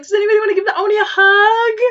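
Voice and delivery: high, quick, chirpy teacher imitation; hammed-up perky question; mock cheeriness. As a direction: invite the kids with overdone brightness. Teachers chirpily inviting frightened kids.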